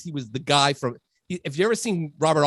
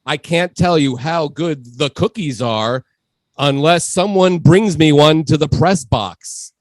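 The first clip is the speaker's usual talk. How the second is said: slow, deliberate mock announcement; deadpan PA announcer; 6 dB louder, slower than usual. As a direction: spell it out slowly and loudly like an announcer. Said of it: "then he goes".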